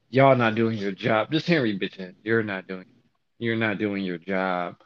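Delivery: low, slow manager voice; mimicking manager. Manager Henry scolding him at the crew meeting, low and slow. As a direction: imitate the manager.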